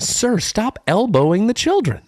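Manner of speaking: very high mock attendant cracking up; an attendant's squeal collapsing into laughs; laughing high scold. Imitating staff scolding.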